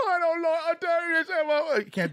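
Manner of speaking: high, drawn-out fake sobbing; unconvincing, very high-pitched tearful wail; fake crying. Shown as a demonstration. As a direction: wail it like an actor badly faking tears. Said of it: "now I'm crying and it doesn't sound real".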